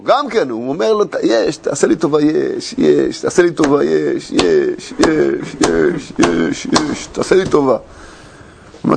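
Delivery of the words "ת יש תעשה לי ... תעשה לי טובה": pleading. Retold or imitated. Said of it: "הוא הפציר בו".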